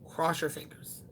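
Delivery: ordinary talk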